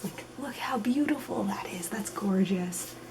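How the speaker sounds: hushed admiring whisper. Real, not acted. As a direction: breathe it out in awe.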